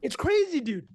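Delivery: high, amazed bro voice; mock amazed. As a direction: play the stunned commenter. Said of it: "people were like".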